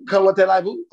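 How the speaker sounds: tossing out a French greeting in a put-on French accent; mock French greeting. A friend fumbling a greeting abroad, in mock French accent.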